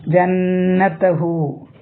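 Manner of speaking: ജന്നത ഹു recited very slowly, the hu stretched two counts; two-count lengthening. Shown as a demonstration. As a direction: draw out ഹു for two beats. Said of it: "രണ്ട് count നീട്ടുക".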